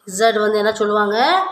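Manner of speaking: ordinary talk, nothing marked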